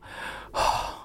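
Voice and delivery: long, breathy relieved sigh; long sigh of relief. Real, not acted. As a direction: let out a long, heavy sigh. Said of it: "一个长叹了".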